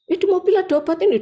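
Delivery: quick, high retold urgency; hurried quote. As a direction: blurt it out fast and high. Suami panik mencari obat. (ucapan tergesa-gesa, nada tinggi)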